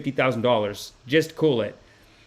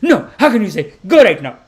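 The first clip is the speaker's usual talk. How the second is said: much higher, louder mock ranting aunt; imitating the mad aunt shouting, with a laugh; furious aunt; 12 semitones higher, 10 dB louder than usual. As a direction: screech at him to leave. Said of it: "She's like".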